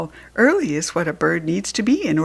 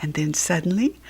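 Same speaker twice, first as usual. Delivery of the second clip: usual speaking voice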